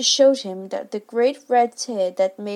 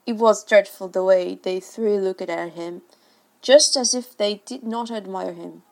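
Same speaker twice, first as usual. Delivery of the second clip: plain reading voice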